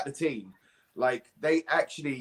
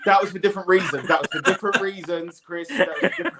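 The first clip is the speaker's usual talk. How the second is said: laughing while insisting on an excuse; laughing protest; 4 semitones higher, 5 dB louder, faster than usual. Real, not acted. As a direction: protest through real laughter, louder. Protesting through laughter when teased.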